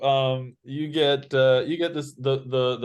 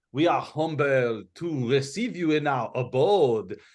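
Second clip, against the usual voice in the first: put-on higher-pitched, theatrical French accent; 4 semitones higher than usual. Game master reads a lavish invitation to adventurers, in a French accent. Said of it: "in the mood for a French accent".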